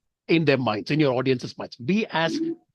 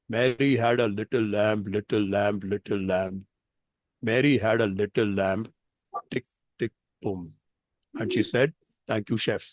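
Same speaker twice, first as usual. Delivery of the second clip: lower, deadpan joke delivery; deadpan humor; 5 semitones lower, 3 dB quieter than usual. Shown as a demonstration. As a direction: drop the pitch and let the punchline land dry. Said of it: "I say that very slowly, deadpan humor".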